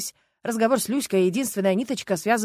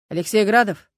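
official; 7 dB louder than usual. A narrator's reading. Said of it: "официально сказал он".

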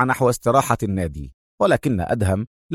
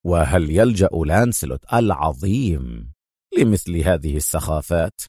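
low, mocking question; mock him in a deep, unimpressed voice; sarcastic; 8 semitones lower than usual. A narrator's reading. Adham scoffing at his rival's threat, sarcastic. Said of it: "قال أدهم ساخرا".

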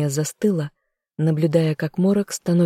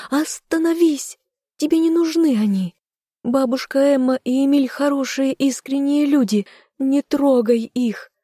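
hysterical; 9 semitones higher than usual. A narrator's reading. Heroine begging the fog to spare friends, hysterical. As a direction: beg frantically in a high voice. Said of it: "голос звучит истерично".

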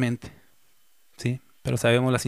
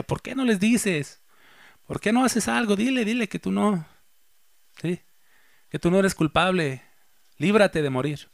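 pleading with Jesus; 6 semitones higher than usual. Retold or imitated. Preacher retells begging Jesus on screen, high pleading voice. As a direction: urge him to speak up and save himself. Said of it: "yo decía".

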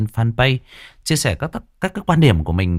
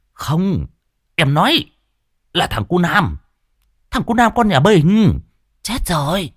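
high, insistent village woman; frantic woman; 9 semitones higher than usual. Acted. Mai insists to deaf old Cẩm, high and urgent. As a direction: push the news through, high and urgent.

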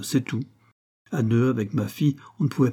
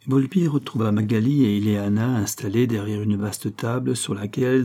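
ordinary delivery, nothing marked

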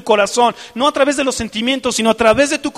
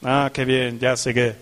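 mock dull delight; 9 semitones lower than usual. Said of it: "¿Y cómo es regocijo?".

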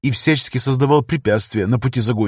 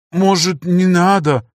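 жалобно мямля; 6 semitones higher, slower than usual. Acted. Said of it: "промямлил он".

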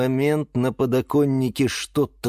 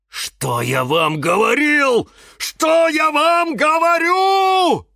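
slow, drawn-out shout climbing very high; yelling; 5 dB louder, slower than usual. A narrator's reading. Cactus warning the other plants, yelling. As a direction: howl it out, building to a peak. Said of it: "продолжал вопить кактус Кирилл".

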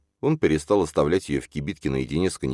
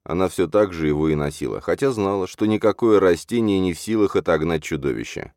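usual speaking voice

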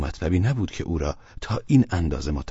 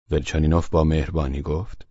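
ordinary delivery

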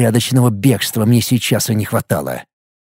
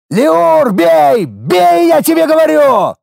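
high, loud voice-cracking yell; shouting hoarsely; 4 dB louder, slower than usual. A narrator's reading. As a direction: scream at the hesitating mage. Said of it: "кричу, срывая голос".

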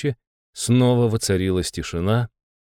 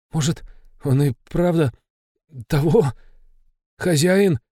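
trembling whisper; 6 semitones higher than usual. Acted. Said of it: "дрожащим голосом прошептал Лёха".

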